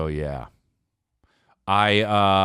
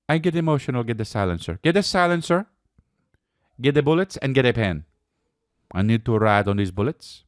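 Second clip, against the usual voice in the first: higher cartoon villain voice; mock Luigi; 7 semitones higher than usual. As Luigi planning the shooting, a higher hammed-up cartoon voice. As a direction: ham up a goofy villain's plan. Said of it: "continuing Luigi impression".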